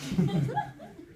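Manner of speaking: loud breathy laugh; burst of laughter. Real, not acted. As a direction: crack up loudly. Laughs at own joke.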